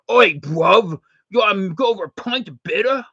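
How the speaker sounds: loud, high mock Cockney; over-the-top British pub bloke accent. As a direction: ham up a London geezer, British accent. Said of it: "Was that any good?".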